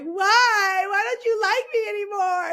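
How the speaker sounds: high, loud hammed-up wail; mock ugly crying. Retelling plea to friend who dropped out, mock sobbing. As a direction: wail it like a comic tearful tantrum. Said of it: "I cried, like, with her, very ugly".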